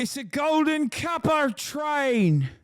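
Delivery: high, slow hammed-up British voice; mock British accent. Mocking the British speaker in clip.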